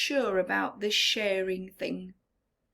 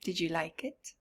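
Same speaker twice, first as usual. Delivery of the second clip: ordinary delivery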